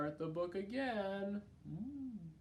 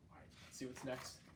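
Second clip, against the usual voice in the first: ordinary delivery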